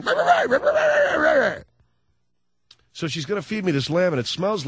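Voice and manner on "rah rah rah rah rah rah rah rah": high, loud, yapping barks; a comic shouted impression of a barking dog; imitated dog barking. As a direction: yap like a small, frantic dog. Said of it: "With the dog going".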